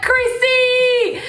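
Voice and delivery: high, loud calling her name; mock exasperated call. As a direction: imitate boyfriend shouting her name. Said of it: "And he was like".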